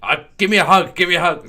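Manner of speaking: high, loud, eager plea; pleading Moyes. Retold or imitated. Moyes begging for a hug, high and loud. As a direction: call out insistently for affection.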